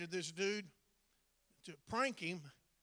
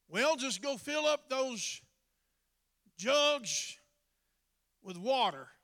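high, loud, slow command; Jesus commanding; 6 semitones higher, 10 dB louder, slower than usual. Acted. Jesus ordering the servants, loud slow command. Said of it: "Jesus turns to them and says".

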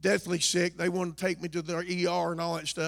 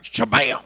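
shabam impact; 4 semitones lower, 9 dB louder than usual. Acted. Sudden trouble hits.

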